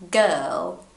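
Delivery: put-on girl in British English with the R very small. Shown as a demonstration. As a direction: say girl the British way, the R almost gone. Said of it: "transforming it into British English".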